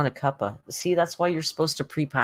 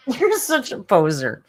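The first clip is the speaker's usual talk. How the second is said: teasing cat talk; 5 semitones higher, 7 dB louder than usual. Real, not acted.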